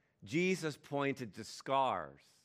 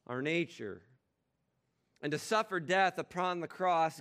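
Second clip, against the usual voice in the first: steady, measured prayer voice; liturgical prayer; 5 semitones higher, 4 dB louder than usual. Acted. Preacher leads the congregation in prayer, reverent and measured.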